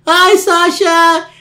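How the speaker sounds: slow, loud mocking falsetto; mimicking the fake girl. Caller mocks Sasha over the phone, mimicked girl voice. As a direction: throw the fake girl voice back at them. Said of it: "Let me do it back to you".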